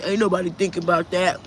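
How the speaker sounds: a hint of a dismissive commenter voice; mocking a commenter. Mimicking a viewer who dismissed the prediction, lightly mocking voice. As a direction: lightly impersonate the scoffing viewer.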